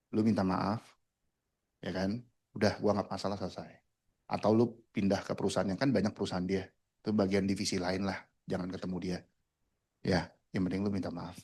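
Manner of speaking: low, quiet, retold coaxing; conciliatory uncle. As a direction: gently talk someone into apologizing, as an uncle. Uncle quietly offers nephew a compromise.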